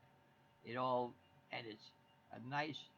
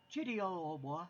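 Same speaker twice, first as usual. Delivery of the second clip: higher, hammed-up posh British accent; mock posh British; 7 semitones higher, 4 dB louder than usual. Husband hamming up a posh British accent for wife. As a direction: play a pompous Englishman over tea. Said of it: "The only thing I can do for Brit talk".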